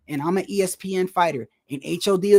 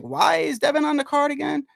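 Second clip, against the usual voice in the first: mock baffled commentator; 8 semitones higher than usual. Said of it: "They were like".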